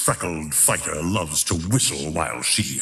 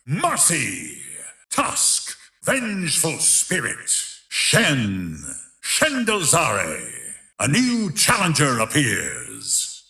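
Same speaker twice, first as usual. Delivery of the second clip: announcer callout; 6 semitones higher, slower than usual. Acted. Arena announcer calling names, rounds.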